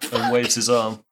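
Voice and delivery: usual speaking voice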